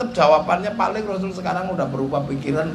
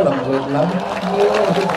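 soft and gentle; 3 semitones lower, much slower than usual. Shown as a demonstration. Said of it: "orang Sampang lembut".

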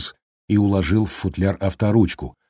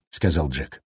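ordinary delivery, nothing marked

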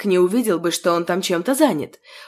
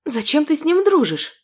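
dismayed; 6 semitones higher than usual. Acted. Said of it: "сокрушённо спросила Нинель".

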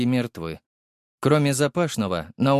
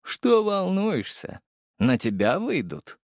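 mocking villain; 7 semitones higher, slower than usual. A narrator's reading. Villain toying with nervous gendarme.

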